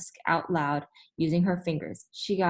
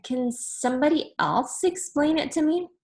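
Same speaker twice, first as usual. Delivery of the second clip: higher, puzzled teacher asking; 8 semitones higher than usual. Acted. Teacher to the class, asking for help, high and puzzled. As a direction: plead sweetly for another explanation.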